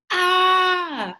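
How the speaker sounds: sudden high yelp of panic; panicked cry. Acted. Kid narrator yelping at his friendless problem, panicked. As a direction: cry out in alarm.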